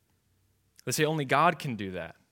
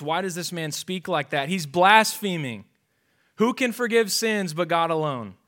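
high, loud, scandalized objection; indignant scribes; 5 semitones higher, 8 dB louder than usual. Acted. Scribes objecting to Jesus, loud and scandalized. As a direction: cry out that it is blasphemy.

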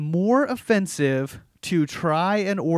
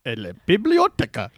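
tourist Spanish; 5 semitones higher than usual. Retold or imitated.

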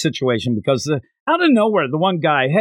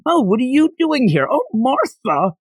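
surprised greeting; 10 semitones higher than usual. Retold or imitated. Surprised old man greeting.

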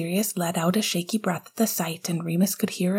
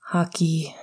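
wistful. Acted. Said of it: "Sirius said wistfully".

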